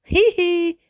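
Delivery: very high, loud hee-hee; high tittering laugh; boy's giggle. A narrator's reading. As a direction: giggle like an amused child. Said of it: "Eemeli sanoo".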